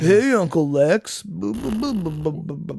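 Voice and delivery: movie character impression. Podcaster quoting Superman four to a co-host, hammed-up movie impression. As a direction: ham up the line, then babble boo-boo sounds. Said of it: "Oh, Superman four".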